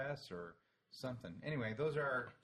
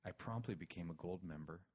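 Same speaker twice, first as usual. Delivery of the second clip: hushed NPR voice; 5 semitones lower than usual. Pretend. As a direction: read the letter in a mock-solemn NPR hush. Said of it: "N P R".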